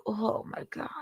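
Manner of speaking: breathless shocked whisper; whispered oh my God. Real, not acted. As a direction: whisper it in horror. Shocked aside after reading.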